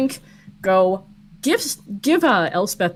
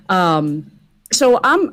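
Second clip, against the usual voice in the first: ordinary talk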